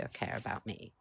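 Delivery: drops to a breathy whisper on me; whispered aside. Retold or imitated. To someone being rude, a whispered self-affirmation. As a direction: whisper it, almost to yourself. Retold telling off a rude person.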